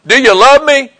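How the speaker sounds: upset retelling. Retold or imitated. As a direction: act out an upset, insistent question. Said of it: "Then he gets all upset".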